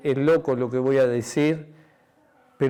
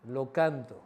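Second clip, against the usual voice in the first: soft, much quieter sermon close; hushed preaching; 2 semitones lower, 11 dB quieter than usual. Acted. Pastor closing the sermon to his church, hushed and intimate. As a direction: draw the congregation in softly.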